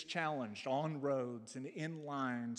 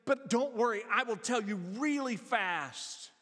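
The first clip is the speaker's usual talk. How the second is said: put-on high, louder little girl's plea to her father; child's urgent appeal, voiced higher; young daughter; 7 semitones higher, 4 dB louder than usual. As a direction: lightly imitate an eager daughter, pitched up. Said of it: "she went to him and she said".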